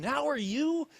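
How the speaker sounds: high, self-mocking echo; mocking own question. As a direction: parrot your own question back in scorn. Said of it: "what a stupid question".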